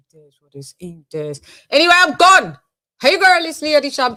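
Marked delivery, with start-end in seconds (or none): 1.7-2.6 high loud upbeat goodbye; sign-off. Acted. Sign-off catchphrase. 3.0-4.2 high loud upbeat goodbye; sign-off. Acted. Signature greeting.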